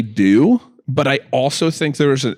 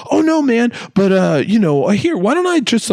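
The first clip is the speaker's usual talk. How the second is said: high, eager insisting; mock salesman; 12 semitones higher than usual. Clerk refusing to let Ian go, in a high, eager voice. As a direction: keep pushing, brightly. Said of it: "the guy's like".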